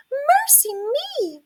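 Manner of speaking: high, slow little squeak; a high mouse squeak of dismay; squeaky mouse. A narrator's reading. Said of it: "squeaked Mouse".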